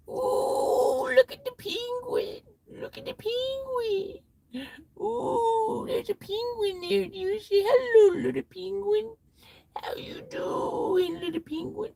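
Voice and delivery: put-on high cooing baby-talk voice to a penguin; Billy Madison penguin bit, high and cooing; Adam Sandler impression. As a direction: coo at the little penguin, way up high. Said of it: "I can do some Adam Sandler for you guys".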